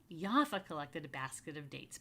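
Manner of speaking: ordinary delivery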